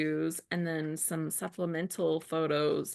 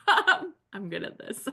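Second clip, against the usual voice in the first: laughing at herself; 4 semitones higher, 6 dB louder, slower than usual. Real, not acted. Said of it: "laughing at own fumble".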